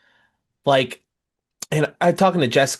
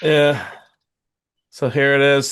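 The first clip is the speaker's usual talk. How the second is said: ordinary talk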